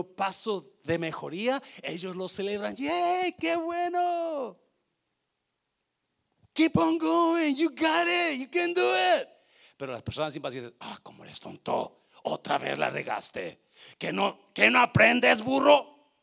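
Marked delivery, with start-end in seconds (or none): 2.7-9.3 high, loud cheering encouragement. Retold or imitated. Preacher voicing a supportive friend, high excited cheering. Patient people cheering you on. 10.8-16.1 mock scolding. Impatient person insulting you.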